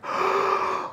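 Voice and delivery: bigger gasping intake of air; huge gasp. Acted. The boy filling his lungs before diving, a huge gasp. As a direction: gulp in an enormous breath. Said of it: "takes an even bigger breath".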